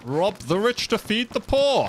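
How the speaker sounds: high, slow hammed-up proclamation; drawn-out, high-pitched slogan; mock heroic cry. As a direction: declaim it like a playful rallying call.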